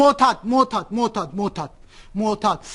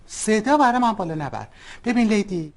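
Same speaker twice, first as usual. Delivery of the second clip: normal talking voice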